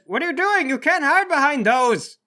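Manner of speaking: high, loud protest as the doctor; alarmed doctor. Acted. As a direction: cry out in indignant alarm. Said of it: "The doctor says".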